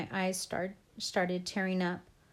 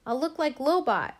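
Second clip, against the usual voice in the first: high, loud angry complaint; boy angrily dreading how he will look; 8 semitones higher, 7 dB louder than usual. A narrator's reading. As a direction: throw it out in anger. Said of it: "I said angrily".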